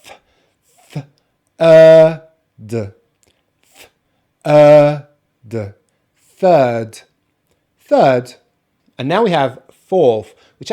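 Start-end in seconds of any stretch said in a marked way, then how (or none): 0.0-5.7 "th", long loud "er", "d", each separated; segmented demo of "th", "er", "d"; sound-by-sound breakdown. Shown as a demonstration. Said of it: "start with the T H sound". 6.2-8.3 "third" with a long "er" and silent R; careful British "third"; non-rhotic pronunciation. Shown as a demonstration. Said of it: "not gonna pronounce the letter R".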